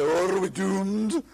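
loud, drawn-out Scottish wail of doom; slow mock-tragic Scottish accent. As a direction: ham up a doom-struck Scotsman. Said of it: "bit too like your accent".

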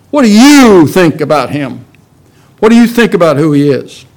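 What do you think, with you hit hard each time; stress on you. Shown as a demonstration. Pastor quoting Jesus to the congregation, punching you. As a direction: lean on you, make it personal. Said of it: "emphasis on the word you".

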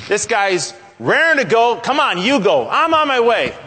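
high, loud, eager volunteer; raring to go. Acted. Preacher voicing who God could have picked instead, raring to go. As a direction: jump at the job, voice pitched right up. Acted keen young prophets' sons.

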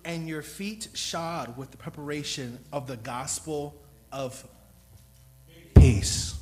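loud, rising proclamation ending in a shouted peace; proclaimed scripture. Real, not acted. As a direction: call the verse out to the room. Reading scripture aloud to congregation.

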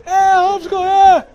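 very high, loud singsong greeting; cheery honker. Retold or imitated. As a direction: wave and cheer like a fan. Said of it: "they wave".

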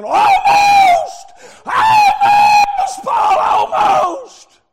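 very high, long-held shout; imitating a lost soul's scream at full force; anguished scream. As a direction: cry each word out from the pit. Agrippa crying out from hell.

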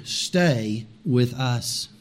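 very slow, low, weighty appeal; slow, deep, deliberate plea; urging, drawn out. A narrator's reading. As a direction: stretch each word out for emphasis. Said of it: "They urged him".

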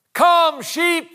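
high, loud two-word call; hillside yell. Acted. Pastor calling his sheep up the hillside, yelled high and loud. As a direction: yell it out to a distant flock. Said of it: "he yells up that hillside".

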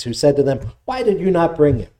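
ordinary delivery

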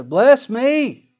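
high, loud begging plea to a father; bitter pleading cry. Acted. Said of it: "he cried with a great and exceeding bitter cry".

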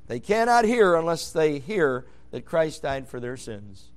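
usual speaking voice

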